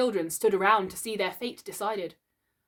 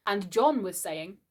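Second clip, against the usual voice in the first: plain reading voice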